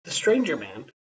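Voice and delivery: ordinary delivery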